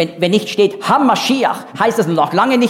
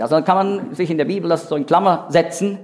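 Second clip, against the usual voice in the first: usual speaking voice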